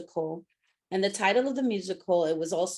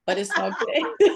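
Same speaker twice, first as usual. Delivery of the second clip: laughing; 4 dB louder than usual. Real, not acted.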